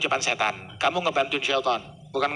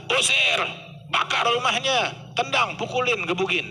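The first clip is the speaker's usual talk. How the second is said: angry crowd; 7 semitones higher than usual. Pretend. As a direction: bark out the punishments like an angry mob. Imagined angry crowd reaction. (teriakan marah massa)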